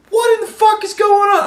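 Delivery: loud, very high-pitched incredulity; mock outraged disbelief. Viewer reacting to candidates tripping over each other, loudly incredulous. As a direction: cry it out high and loud, hammed-up. Retold escalating disbelief at debate.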